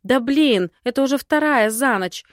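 heated, fed-up outburst; exasperated exclamation. A narrator's reading. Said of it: "воскликнула в сердцах".

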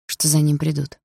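ordinary delivery

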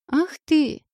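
high playful indignant cry; mock outrage. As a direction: squeal in teasing outrage.

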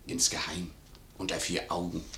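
a whispered, conspiratorial hint; secretive whisper. Acted. Servant hints to the maid in a secretive whisper. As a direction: breathe the word like a secret.

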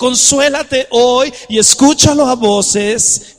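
rising pastor's cry; fervent preaching. Acted. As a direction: proclaim it to the crowd. Preaching at full force.

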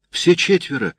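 "Все четверо" said up high. A narrator's reading. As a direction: lift it the first time. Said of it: "repeats the words". (первый раз выше)

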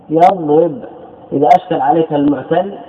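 ordinary talk

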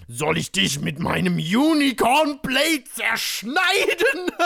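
slow threat swelling high and loud; villainous threat. Acted. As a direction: build to a maniacal mock shout. Shadow threatens, building into mad laughter.

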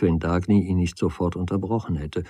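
ordinary delivery, nothing marked

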